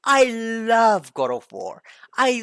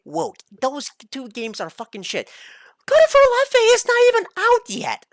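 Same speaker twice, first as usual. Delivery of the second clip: ordinary talk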